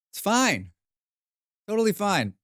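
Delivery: mock fine. High far reassuring, mock fine.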